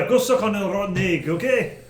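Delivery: gruff Scottish brogue; rough Scottish voice; Scottish accent. Acted. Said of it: "That was more Scottish".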